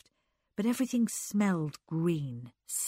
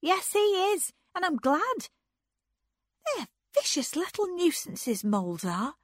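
put-on defiant girl; 11 semitones higher than usual. A narrator's reading.

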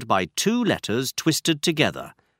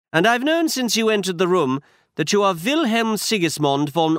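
a slightly higher, brisk Holmes; Holmes, lightly voiced; 8 semitones higher than usual. A narrator's reading. Holmes instructing Watson, lightly voiced detective.

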